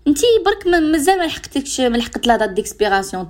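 high, insistent warning; emphatic warning. Real, not acted. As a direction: press the point home, high. (تحذير بنبرة عالية ملحّة)

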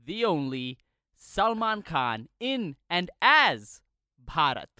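slow, loud, grand announcement; announcer hype. Acted. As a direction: build it up like a trailer. Hyped announcer introducing star.

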